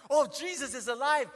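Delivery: high, loud burst of joy; excited cry. Acted. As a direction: shout it out in sudden delight. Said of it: "you can just imagine his excitement".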